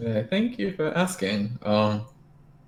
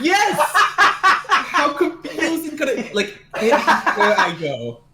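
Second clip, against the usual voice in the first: laughing; 11 semitones higher than usual. Real, not acted. Laughing retelling, high and slow.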